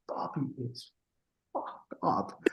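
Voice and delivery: hushed whisper, barely voiced; whispered aside. Real, not acted. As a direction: drop to a whisper to share something sensitive. Confiding aside about Bobby.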